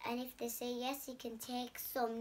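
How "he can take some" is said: plain reading voice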